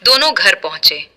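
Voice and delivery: plain reading voice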